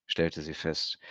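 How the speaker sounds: ordinary delivery, nothing marked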